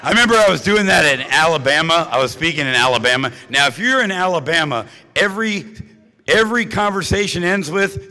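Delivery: ordinary talk, nothing marked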